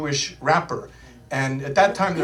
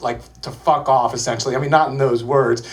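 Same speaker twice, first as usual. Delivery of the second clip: normal talking voice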